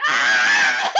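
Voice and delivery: loud mock wail; comically flailing yell, breaking into laughter; imitated scream. Woman being restrained on the ground, loud mock scream.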